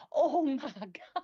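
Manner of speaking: high, loud, slow gasp of wonder; mock awe echoing in a cave; imitating Diane's awe. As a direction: stretch it out in awe at the stalactites. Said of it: "she's like".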